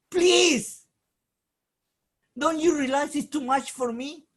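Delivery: loud, high cry of please; anguished plea. Acted. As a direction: cry out for relief. Moshe pleading with God.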